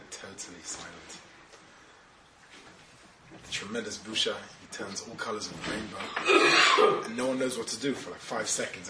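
hushed storytelling. A narrator's reading.

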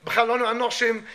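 normal talking voice

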